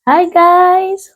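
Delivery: bright high sing-song greeting; excited. Real, not acted. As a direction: call out hi to viewers. Cheerful show intro greeting.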